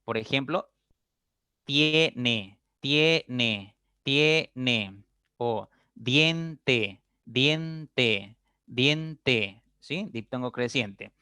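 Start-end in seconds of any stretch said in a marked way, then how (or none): 1.6-5.0 "tiene" split into tie-ne, first part held; slow pronunciation demo. Shown as a demonstration. Teacher giving an I-E example, "tiene" syllable by syllable. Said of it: "La unión de I E". 5.9-9.6 "diente" split into dien-te, stretched; slow pronunciation demo. Shown as a demonstration. Teacher giving an I-E example, "diente" drawn out. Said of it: "La unión de I E".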